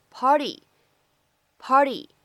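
party said as pardy; flap t. Shown as a demonstration. 老师示范 party 的美式闪音. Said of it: "字母T也是一个闪音的处理".

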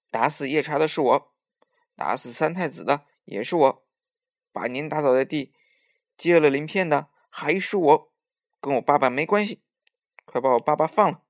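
loud, insistent, building declaration; defiant confession. A narrator's reading. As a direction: own up boldly and demand his release. Nezha defiantly owns up before enemy.

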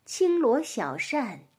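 slow, measured classical poem reading; poem recitation. Acted. Reading a Tang poem to a child, slow recitation. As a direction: recite slowly, with space between phrases.

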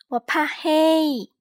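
high, slow, small cub voice; tiny cub afraid of the dark; Little Bear voice. A narrator's reading. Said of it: "cub speaks".

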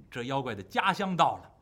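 plain reading voice

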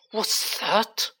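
high-pitched, spat question. A narrator's reading. Malfoy challenging the elf, spitting the question. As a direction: spit it out, pitched well up. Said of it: "spat Mister Malfoy".

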